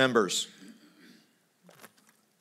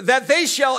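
fiery preaching; 8 semitones higher, 5 dB louder than usual. Acted. Preacher at full force.

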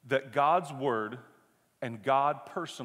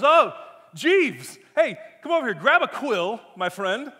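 hammed-up British gentleman, higher and louder; British accent; 4 semitones higher, 8 dB louder than usual. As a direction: call grandly to the butler in a mock British accent. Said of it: "why Paul is British".

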